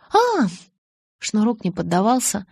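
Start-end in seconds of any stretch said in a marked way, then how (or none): 0.1-0.5 high little 'oh' of surprise; a quick high exclamation as it dawns; sudden realisation. A narrator's reading. Bella learns the gift is inside, surprised realisation.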